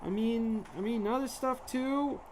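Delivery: very high mouse squeak; squeaky mouse voice. Pretend. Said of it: "a mouse that was actually squeaking".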